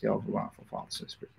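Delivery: hushed closing of the prayer; whispered prayer. Real, not acted. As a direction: let the prayer die away.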